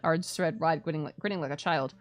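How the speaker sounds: ordinary delivery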